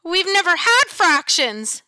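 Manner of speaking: put-on high, loud kid's shout; a boy shouts back at the monster teacher; shouted protest. A narrator's reading. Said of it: "shouts Derek Bloom".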